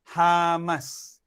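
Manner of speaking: ordinary talk, nothing marked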